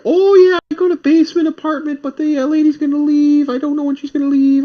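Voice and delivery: high-pitched mock old landlady voice; imitated older lady. Old lady tells apartment hunter about a basement, high imitation. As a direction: put on a high older lady's voice. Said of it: "It was an older lady".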